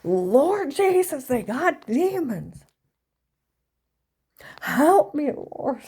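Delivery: loud, high, slow cry of Lord Jesus; hammed-up holy scream at strangers; mock screaming. Mother screaming at stalkers, loud high mock cry. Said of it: "she would have screamed at these people".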